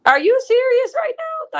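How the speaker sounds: high, loud, incredulous cry; can't believe what she's hearing; shocked, high-pitched are you serious; outraged disbelief. Retold or imitated. Retold outraged reply to husband.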